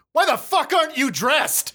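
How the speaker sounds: high, incredulous snap at the sloppy recruit; outraged at the lack of dress; exasperated anger. Acted. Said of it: "looks you up and down and says".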